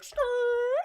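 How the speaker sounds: loud, high mouth-made tire screech; imitated car screech. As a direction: screech like skidding tires. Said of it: "a car was like".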